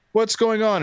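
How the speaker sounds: high, panicked question; alarmed, asking what's happening; mock panic, high and urgent; freaking out. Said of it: "I'm all freaking out".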